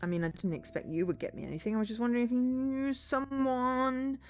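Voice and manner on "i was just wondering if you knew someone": slow, high, stretched-out wheedle; hedging. Acted. Tyler hedges his request for drugs, wheedling. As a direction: draw the words out, trailing unfinished.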